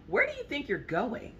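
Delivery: high, teasing where do you think; wife to husband leaving, high mock suspicion; mock suspicious wife. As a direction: playfully call out the husband.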